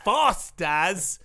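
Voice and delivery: normal talking voice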